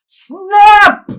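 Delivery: freaking out. Retold or imitated. Telling friends how he panicked over the buzzing broken drone, yelling. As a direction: panic and blurt out "Snap!". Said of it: "I'm literally freaking out".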